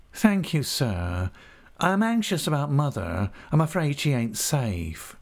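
high, wheedling and obsequious; cringing humble clerk. A narrator's reading. Uriah humbly asking Creakle leave to write to mother. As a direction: creep and fawn as you ask.

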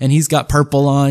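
ordinary delivery

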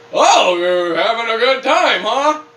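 slow, high, loud mock taunt; mocking the meter reader. Mimicking the meter reader woman, slow and mocking. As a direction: drawl it out like a snooty visitor. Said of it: "she goes".

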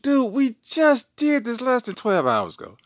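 high, loud mock outrage; exasperated protest. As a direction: squawk it like a put-upon dad. Retells his protest to daughter.

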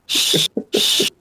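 hushing. Acted. Soothing the scared goat with a hush. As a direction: shush a frightened animal.

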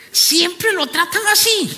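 ordinary delivery

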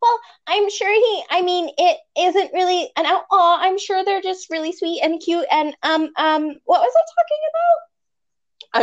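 high-pitched, flustered worry; worried. Acted. Jennifer defending the outlaw, all worried. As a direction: ramble nervously, pitched well up. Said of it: "all worried".

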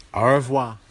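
a quick mock French sign-off, lightly accented. Host signs off the show in a playful French accent. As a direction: toss off a hammed-up French goodbye. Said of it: "I'm going to have to learn French".

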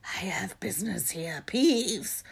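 hoarse croaked whisper; croaking. A narrator's reading. Harry bluffing Peeves as the Baron, croaking. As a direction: croak it like a stern ghost. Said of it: "croaked Harry".

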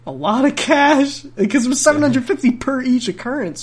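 rising high in disbelief; exasperated. Real, not acted. Telling the listener the fine total, high and exasperated. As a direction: stretch it out, incredulous at the sum.

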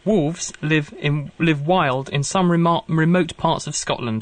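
slow, stumbling read with a restart; halting first read. Real, not acted. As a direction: feel your way through the words slowly. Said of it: "Wolves live wild in some remote parts of Scotland".

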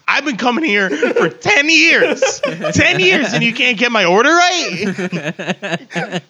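loud, laughing, hammed-up complaint; mock angry customer. To the Taco Salito staff, a hammed-up furious rant. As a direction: play the outraged regular, half laughing.

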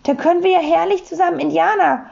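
high, quick, eager burst; delighted. A narrator's reading. Sams plans playing Indians with papa, delighted. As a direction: rush it out with delight. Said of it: "rief das Sams erfreut".

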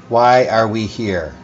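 ordinary talk, nothing marked

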